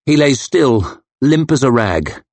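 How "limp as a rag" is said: ordinary delivery, nothing marked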